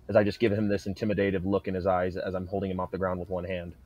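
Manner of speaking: ordinary talk, nothing marked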